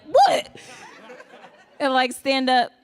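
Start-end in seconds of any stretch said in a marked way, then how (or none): 0.0-0.6 very high, loud mock "what?"; exaggerated, sharp comic outburst; imagined startled reaction. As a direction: yelp it like a celebrity suddenly called out. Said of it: "he's gonna be like".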